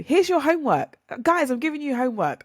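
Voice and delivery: high, loud, excited; excited announcement. Real, not acted. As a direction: pitch it up like a fun teacher.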